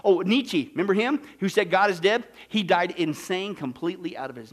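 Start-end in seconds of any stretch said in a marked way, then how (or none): none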